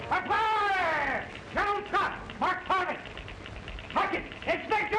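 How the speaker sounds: excited high shouting; early talkie film. Acted. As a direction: yell out the victory news, thrilled. Telegraph operator hears of victory.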